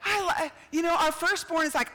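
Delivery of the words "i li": choked-up parent. Retold or imitated. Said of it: "You're like".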